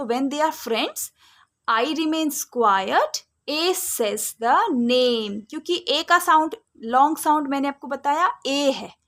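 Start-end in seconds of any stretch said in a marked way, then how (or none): 0.0-5.4 very slow, word by word; slow rule recital. Shown as a demonstration. Teacher repeating the rule very slowly to children. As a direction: stretch every word for the class. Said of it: "दो vowel साथ में हैं".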